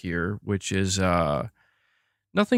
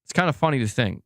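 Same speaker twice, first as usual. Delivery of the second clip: normal talking voice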